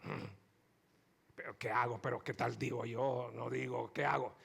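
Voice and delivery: low, soft hesitant inner voice; Bartimeo musing to himself. Retold or imitated. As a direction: ponder quietly like a blind beggar unsure what to do. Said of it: "Bartimeo no dice".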